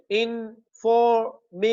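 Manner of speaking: "Information" slowly split into इन, फॉर, मे, शन; syllable by syllable. Shown as a demonstration. Teacher counting syllables of "information" for students, slowly separated. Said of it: "four syllable है हमारे पास".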